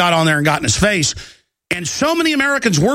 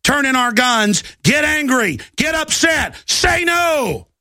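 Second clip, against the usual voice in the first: climbing higher with each command; rallying shout; 6 semitones higher, slower than usual. Real, not acted. Host rallying listeners against gun seizure, rising rallying cry. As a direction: urge the crowd to rise up.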